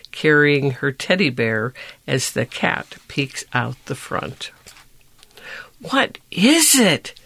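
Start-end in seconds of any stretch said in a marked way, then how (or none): 5.8-7.2 Sophia asking. A narrator's reading. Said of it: "said Sophia".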